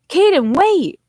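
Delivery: high, loud call after a runner; yelling after someone. A narrator's reading. Chasing footsteps through the woods, yelling after them. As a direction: yell to make him stop. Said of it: "I continued yelling".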